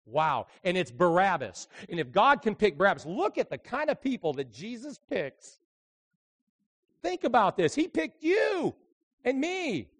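loud, high, rousing pulpit delivery; impassioned preaching. Real, not acted. Preacher telling congregation whom Jesus picks, impassioned preaching. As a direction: drive it home to the congregation.

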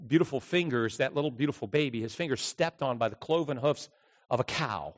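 normal talking voice

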